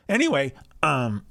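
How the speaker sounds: real voice crack, pitch jumping up; voice breaking. Real, not acted. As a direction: let the voice crack mid-word. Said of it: "oh, my voice broke".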